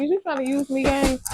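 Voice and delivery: high sassy is-you-trying-to-use-me; suspicious call-out. Retold or imitated. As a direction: call him out playfully. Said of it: "I'm like".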